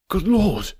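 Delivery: high, startled outburst; astonished exclamation. A narrator's reading. Villain learning who the detective is, high astonished cry. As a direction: blurt it out, amazed. Astonished at hearing famous name.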